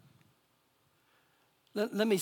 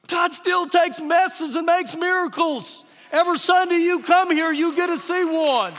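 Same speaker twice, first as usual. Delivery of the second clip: loud, raised, emphatic sermon climax; full-force preaching; 4 semitones higher, 6 dB louder than usual. Real, not acted. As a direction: proclaim it to the whole congregation.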